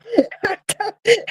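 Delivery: high, helpless laugh bursting out; finally letting the held-in laugh go; real burst of laughter. Real, not acted. Leaving the cemetery with his coworker, a high helpless laugh. Cracking up after the funeral.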